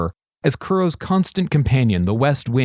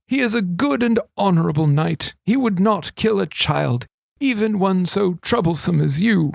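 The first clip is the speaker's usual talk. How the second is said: a hint of a sly old regent; 7 semitones higher than usual. A narrator's reading. Regent confiding to thief, lightly voiced old man.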